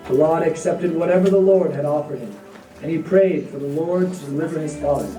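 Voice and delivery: usual speaking voice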